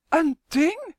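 high, loud shocked question; shocked cry. A narrator's reading. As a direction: cry it out in indignant disbelief. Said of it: "roept Isidora geschokt". (geschokt uitroepen)

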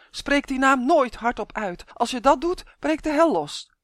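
high, loud, urgent warning; agitated, emphatic prohibition; alarmed yelling. A narrator's reading. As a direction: warn them off in alarm. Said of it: "gilde Malien".

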